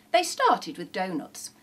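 ordinary delivery, nothing marked

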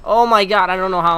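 loud fast amazed rush; excited disbelief. Real, not acted. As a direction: blurt it out in disbelief. Surviving a close call.

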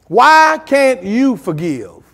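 slow, each word laid out; a slow, pointed question. Shown as a demonstration. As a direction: drag it out so it sinks in. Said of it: "Do I need to say that slow?".

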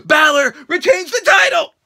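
ordinary talk

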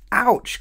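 high, loud yelp of pain; pained ouch. Acted. Little Miss Naughty yelping when her nose is tweaked. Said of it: "cried Little Miss Naughty".

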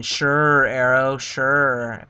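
mock the viewer's disbelief; sarcastic mock quote. Streamer voicing a doubting viewer, sarcastic. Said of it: "And I know you're thinking".

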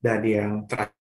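choppy, dropping-out connection; breaking up space radio. Real, not acted. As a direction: voice cutting in and out. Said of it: "Sepertinya suaranya putus-putus ya".

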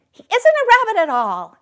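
high, loud alarmed exclamation; exclaiming. A narrator's reading. Wild rabbit exclaiming after sniffing him, high and loud. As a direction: blurt it out in alarm. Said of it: "he exclaimed".

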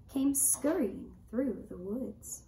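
ordinary delivery, nothing marked

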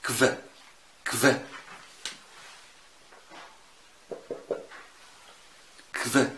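كف with a K straight into a V; German QU as KV. Shown as a demonstration. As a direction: say كف, a K then a V. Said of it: "تتنطق ازاي بقى في الألماني؟". (كف زي ما بتتنطق في الألماني)

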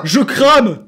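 high, loud cry of alarm; alarmed yell. Real, not acted. Player catching fire in the game, alarmed yell. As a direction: yell out in sudden panic.

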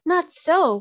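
high, loud objection; crying out. A narrator's reading. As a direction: cry out in protest as a queen. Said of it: "cried the queen".

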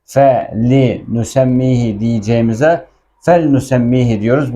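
normal talking voice

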